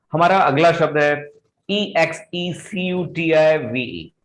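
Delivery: ordinary talk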